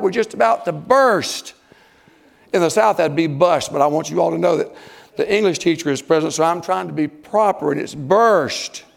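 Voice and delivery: usual speaking voice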